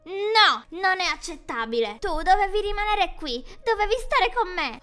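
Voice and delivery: Ronny refusing to let her go; furious outburst. Acted. Ronny shouting at Jenny, loud furious protest.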